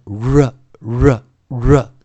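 "r" as r plus a short schwa, ruh; phonics r. Shown as a demonstration. Teacher models the phonics reading, r with a schwa. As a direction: punch out each "r" as ruh. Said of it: "所以我们如果要念成".

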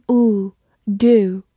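"do" with a long oo; slow, drawn-out "do" rhyming with "too"; long U vowel. Shown as a demonstration. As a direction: stretch the oo in "do" slowly. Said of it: "长音U".

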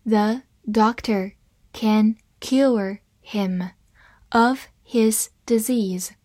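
very slow, word by word; slow reading. Shown as a demonstration. Teacher models the sentence for English learners, slow reading. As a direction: read slowly with a pause after each word. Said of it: "好，慢慢来读".

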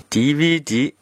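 D V D with the V said as "vi", in a Shanghai tune; D V D in Shanghai style. Shown as a demonstration. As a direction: read D V D the way a Shanghai speaker would, V as "vi". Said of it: "上海方言影响的呢，可能会念成". (上海口音读D V D，V读成"vi")

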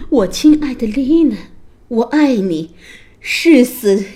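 loud, impassioned prince; ardent vow. A narrator's reading. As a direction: pledge your love forcefully. Prince vows love to princess.

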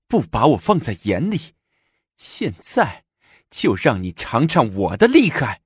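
high-pitched, gloating threat; vindictive. A narrator's reading. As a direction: taunt with triumphant spite. Empress gloats over punished consort. (恶狠狠又得意地威胁)

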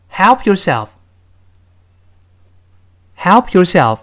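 "help" hit high and loud, then slow "yourself"; stress on help. Shown as a demonstration. Teacher models the line for students to repeat, stress on "help". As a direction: punch "help", draw the phrase out for learners. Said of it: "这个help是重读的".

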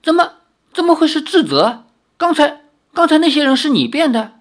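高得多、更响的惊问; 不敢相信地结巴追问. Acted. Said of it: "看得瞪大了眼睛". (震惊地高声追问)

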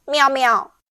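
a cat's indignant meow; angry meow. A narrator's reading. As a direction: meow like an offended cat. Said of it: "气得喵喵叫".

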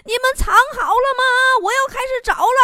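high, loud, quick hollered call; sudden shout. Retold or imitated. Hide-and-seek seeker calling out to those hiding, sudden high shout. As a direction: holler it out suddenly, pitched right up. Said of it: "他突然大喊".

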